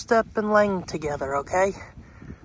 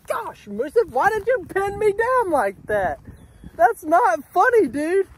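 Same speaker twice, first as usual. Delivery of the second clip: incredulous outburst; 9 semitones higher than usual. Real, not acted. Finally freed from the cow.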